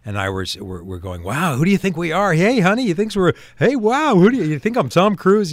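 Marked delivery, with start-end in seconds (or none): 1.2-5.5 hammed-up surprised couple, much higher and louder; bad acting. Husband hamming it up to his movie wife, mock amazed. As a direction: overplay the flattered celebrity act. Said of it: "we were doing that kind of bad acting".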